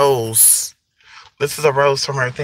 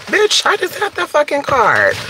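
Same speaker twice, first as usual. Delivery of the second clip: sudden squeal, much higher; high-pitched outburst; 10 semitones higher than usual. Real, not acted.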